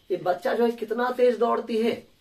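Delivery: usual speaking voice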